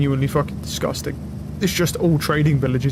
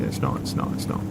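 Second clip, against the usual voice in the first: whispered denial; 11 semitones lower, 7 dB quieter than usual. Real, not acted. Nervous hope, whispered denial.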